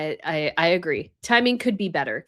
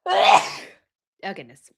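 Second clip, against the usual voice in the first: surprised; 3 dB louder, much slower than usual. Real, not acted. Recovering right after sneezing.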